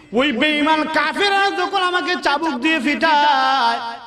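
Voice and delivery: high, slow sung lament of the faithful; Bilal answering. Acted. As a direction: chant it like a devoted martyr pouring his heart out. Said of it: "Belal রাদিয়াল্লাহু তাআলা আনহু ডাক দেন".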